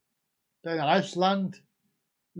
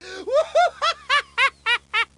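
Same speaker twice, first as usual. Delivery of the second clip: real high-pitched burst of laughter; high giggling cackle; 4 dB louder than usual. Real, not acted. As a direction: squeal with laughter. Laughs at posh Waitrose.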